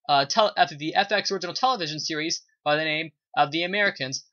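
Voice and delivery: usual speaking voice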